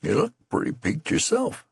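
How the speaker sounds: high, rustic voice of a ragged soldier; a weary private's voice, lifted high and kindly; tattered man, concerned. A narrator's reading. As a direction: fuss over the youth like a worried old comrade. Tattered man speaking to youth.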